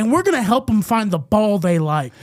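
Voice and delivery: loud, high mock pep talk; manager's shift speech. Employee mocking manager's shift speeches, loud and overblown. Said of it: "he would give pre and post shift speeches".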